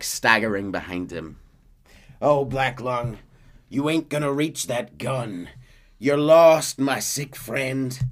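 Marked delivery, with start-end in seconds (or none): 2.2-8.0 slow, louder, higher sneer; gloating Micah. Retold or imitated. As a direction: draw out the gloat. Micah gloating over crawling Arthur.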